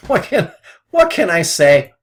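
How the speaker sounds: rueful laughing outburst. Real, not acted. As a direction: laugh at your own bad luck. Laughs at own losing trade.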